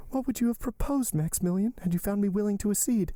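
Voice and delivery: high-pitched heroine, anxious question; Valentine, anxious. Acted. Valentine asking what Maximilian would propose, anxious. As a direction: lift the pitch and appeal to him.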